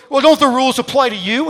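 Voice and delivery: loud, high, fast mocking challenge; hammed-up scolding at full volume; scolding like a schoolteacher. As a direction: rip into them, imitating an angry stranger. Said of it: "starts ripping into me like a school teacher".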